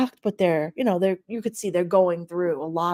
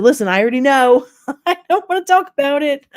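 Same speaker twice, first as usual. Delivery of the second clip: laughing through the words, higher; laughing retort; 7 semitones higher, 7 dB louder than usual. Retold or imitated. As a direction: brush it off with a laugh. Laughing retort to friend's reminder.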